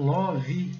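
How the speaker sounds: "love" drawn out as "LAW-vee"; slow "love" as two syllables, "lóvi"; wrong Brazilian "love". Shown as a demonstration. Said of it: "não. É love".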